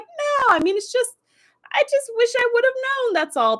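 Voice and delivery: high-pitched, held-tone mock protest; imitated awkward, high-pitched denial; defensive backpedalling. Acted flustered denial of the accusation.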